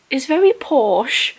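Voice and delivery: drawn-out mock-posh British vowels; attempted posh British accent. Shown as a demonstration. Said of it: "I can't do a posh British accent".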